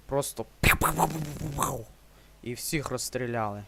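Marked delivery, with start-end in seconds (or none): 0.1-1.8 імітація пострілів. Retold or imitated. Імітує постріли.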